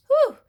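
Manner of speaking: shaky exhale, fighting tears; shaky sigh. Real, not acted. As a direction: let out a trembling breath. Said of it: "I'm trying not to cry right now".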